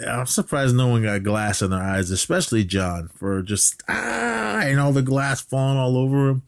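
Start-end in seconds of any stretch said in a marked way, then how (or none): 3.9-4.6 half-hearted high-pitched yell; mock scream. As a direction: imitate a cry under falling glass. Imitates John as glass falls.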